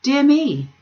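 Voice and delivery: high, loud "Dear me!" in a put-on voice; a startled father's cry; surprised exclamation.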